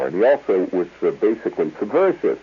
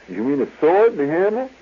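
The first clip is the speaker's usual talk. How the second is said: incredulous floorwalker; 10 semitones higher than usual. Retold or imitated.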